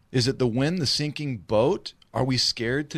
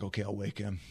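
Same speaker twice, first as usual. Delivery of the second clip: much softer, low-key giving in; reluctant volunteer; 3 semitones lower, 10 dB quieter, faster than usual. Acted. Disciple agreeing to wake the sleeping master, quietly reluctant. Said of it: "Peter's like".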